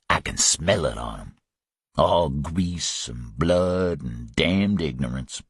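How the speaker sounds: low, slow menacing drawl of a cool killer; Louis, menacing. Acted. Louis sneering at the gunmen, low and slow. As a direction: savour each word like a threat.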